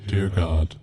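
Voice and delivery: ordinary delivery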